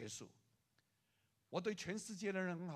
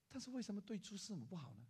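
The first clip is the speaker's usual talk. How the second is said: normal talking voice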